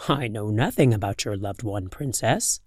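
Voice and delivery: low, calm moon voice. Acted. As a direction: speak low and cool as the moon. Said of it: "replied the moon".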